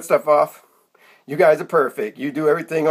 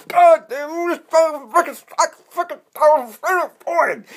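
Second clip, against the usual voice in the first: high-pitched cursing in pain; acted agony; 10 semitones higher, slower than usual. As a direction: swear through sudden pain, pitch way up, words drawn out at the end. Acts out blowing his knee out.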